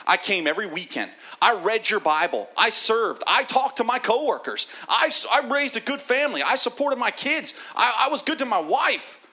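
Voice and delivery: high, loud, indignant listing; proudly tally every good deed; self-righteous list. Acted. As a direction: churchgoer reciting deeds to God with indignation. Believer listing deeds to God.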